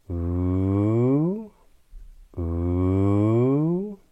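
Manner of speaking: smooth "ooh" sliding upward in pitch; rising glide. Shown as a demonstration. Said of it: "a glide in the pitch of our voice upwards".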